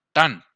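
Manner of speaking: "Tan" said loud and emphatic; stressed syllable. Shown as a demonstration. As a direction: punch "Tan" as the strong syllable. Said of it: "Esa es la que estamos haciendo la fuer".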